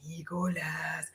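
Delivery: eerie slow whisper. Retold or imitated. Sorceress whispering Nicholas from the book. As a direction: whisper his name like an evil spirit. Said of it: "she's over there just like".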